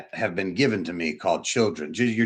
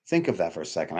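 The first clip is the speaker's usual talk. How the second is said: usual speaking voice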